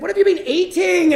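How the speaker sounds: high, loud incredulous question; alarmed disbelief. Retold or imitated. Older brother questions the boy, alarmed disbelief. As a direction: demand it, voice jumping up.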